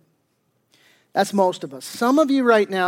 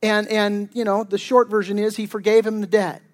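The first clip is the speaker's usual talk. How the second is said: ordinary talk, nothing marked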